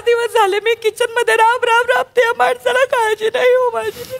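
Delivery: high, plaintive wail; hammed-up weepy lament; tearful complaint. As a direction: pour out your grievance, close to tears. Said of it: "माऊलीच्या डोळ्यात अश्रू आले रे".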